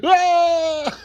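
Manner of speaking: high, loud "aah"; imitated scream of a man plunging; mock falling scream. Retelling his step into the elevator shaft, loud mock scream.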